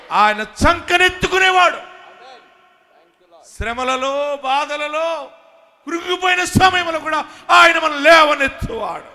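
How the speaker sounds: fervent preacher's shout; shouted preaching. Acted. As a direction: lift the hall with a shout of faith.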